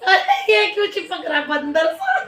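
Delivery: laughing while tickled; giggling through the words; being tickled. Real, not acted. Child clinging, tickling mom.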